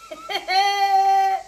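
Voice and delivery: long, high held "eeeh"; high wail. Real, not acted. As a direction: cry out on one held note. Wordless high cry after a jibe.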